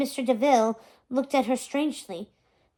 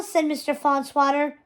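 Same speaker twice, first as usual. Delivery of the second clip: ordinary delivery, nothing marked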